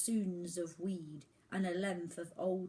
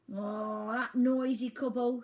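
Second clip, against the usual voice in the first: scornful albatross; 5 semitones higher, 8 dB louder, slower than usual. A narrator's reading. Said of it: "said Mister Culpepper".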